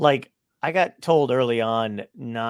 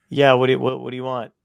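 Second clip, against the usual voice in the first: mock gruff cashier bark, a touch louder; 8 dB louder, faster than usual. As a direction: imitate a brusque cashier lightly. Gruff cashier in retold story.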